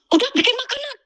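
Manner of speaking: very high-pitched mimicked nagging; mock furious employer. Maid mimics employer ordering food last minute, mock furious. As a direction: imitate an angry boss high up, without shouting. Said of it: "marahnya setengah mati".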